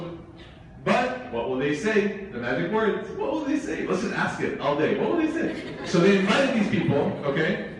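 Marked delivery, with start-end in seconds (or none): 3.1-3.9 mocking gossip. Mocking the magic words. 5.0-5.8 mocking gossip. Mocking the magic words again.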